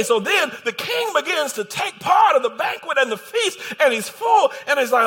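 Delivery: loud, high-pitched sermon delivery; fired-up pulpit storytelling; full-force preaching. Real, not acted. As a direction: preach it at a shout, driving through the story.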